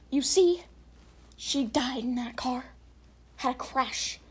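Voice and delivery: slow hushed reveal; serious spooky reading. Acted. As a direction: drop into a grave whisper. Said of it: "I gotta get more serious now".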